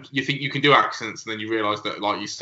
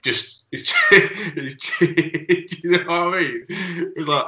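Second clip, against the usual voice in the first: higher, breaking into giggles mid-sentence; laughing; 7 semitones higher, slower than usual. Real, not acted. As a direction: try to explain while cracking up. Cracks up mid-explanation.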